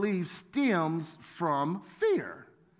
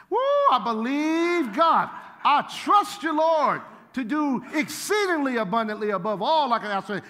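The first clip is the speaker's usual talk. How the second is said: mock excited faith; 5 semitones higher, 5 dB louder than usual. Preacher retelling his broke days, excited mock praise. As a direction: shout your faith like you're putting on a show. Retold shouting faith at God.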